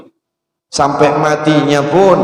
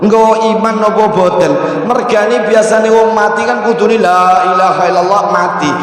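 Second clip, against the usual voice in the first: ordinary delivery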